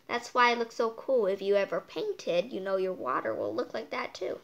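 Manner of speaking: ordinary delivery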